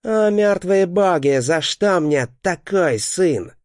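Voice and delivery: high, slow monstrous voice full of disgust; disgusted inhuman voice. A narrator's reading. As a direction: drawl it out in revulsion like a demon father. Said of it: "с отвращением проговаривает он".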